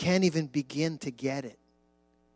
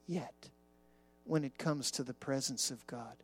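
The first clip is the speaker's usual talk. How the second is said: voice from the throne; 4 semitones lower, 9 dB quieter than usual. Acted. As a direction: let it fade almost to a hush. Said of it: "that voice is seeming to say".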